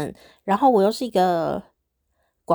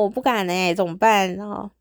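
ordinary talk

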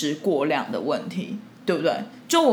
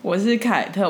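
usual speaking voice

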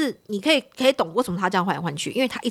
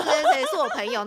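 laughing retort; 8 semitones higher than usual. Retold or imitated. Laughing while retelling own retort.

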